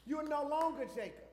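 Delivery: God's booming decree to Jacob; God declaring. Retold or imitated. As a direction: proclaim the new name with power. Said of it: "then God said".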